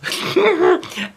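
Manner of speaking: high, short comic laugh; high giggle. Acted. Junie B laughs at her own salute, high giggle.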